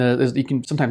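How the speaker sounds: usual speaking voice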